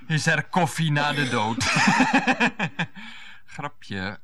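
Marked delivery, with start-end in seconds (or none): 1.7-3.1 a sudden high laugh; husband laughing at his own quip; high burst of laughter. Acted. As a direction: crack up at his own joke.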